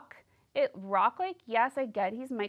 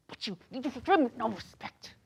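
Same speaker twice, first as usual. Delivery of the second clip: a light imitated tough-girl complaint; half-acted Sarah griping at Michael; Sarah impression; 4 semitones higher than usual. Imitating Sarah scolding Michael, lightly put-on voice. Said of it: "I do a great Sarah impression".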